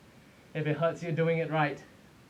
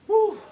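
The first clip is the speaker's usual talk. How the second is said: loud high whoop; 9 dB louder than usual. Real, not acted. Coach cheering at end.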